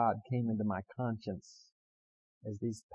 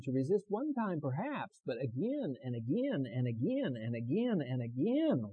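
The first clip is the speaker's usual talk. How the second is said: sermon build; 4 semitones higher than usual. Acted. Preacher describing repeated temptation, sing-song rising cadence. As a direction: lift each again like a cadence.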